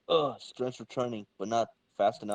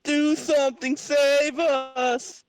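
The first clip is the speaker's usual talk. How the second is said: much higher, louder pleading cry; desperate plea; 6 dB louder, much slower than usual. Acted. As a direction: beg for rescue at the top of your voice. Crowd begs Brother Power.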